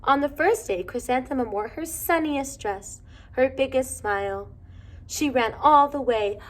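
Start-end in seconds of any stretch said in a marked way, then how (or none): none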